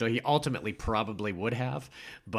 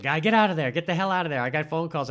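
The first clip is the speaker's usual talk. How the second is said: raised, higher pestering voice; retold banging-on-the-door demand, pitched up; imitating impatient demand; 7 semitones higher, 5 dB louder than usual. Prostitute ordering Stu out of the phone booth, imitated impatient demand. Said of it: "she's, like, beating on the phone booth".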